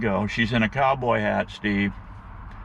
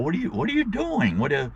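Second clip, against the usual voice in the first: retold panic; 8 semitones higher, faster than usual. Friend startled as she draws the rifle, voice jumps high in panic. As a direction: squeak it out like you're freaking out. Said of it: "I'm starting to freak out".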